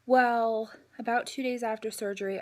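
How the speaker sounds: usual speaking voice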